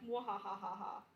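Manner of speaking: slow villain cackle; drawn-out mock evil laugh. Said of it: "And you were like".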